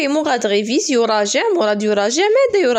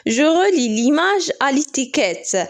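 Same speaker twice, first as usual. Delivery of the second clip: slow instruction read; 3 semitones higher, much slower than usual. Shown as a demonstration. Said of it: "instruction read then repeated".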